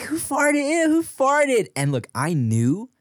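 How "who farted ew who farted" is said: put-on high-pitched disgusted kids' questioning; mock classroom outcry. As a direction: squeal it like grossed-out classmates. Acted classmates outcry over a fart.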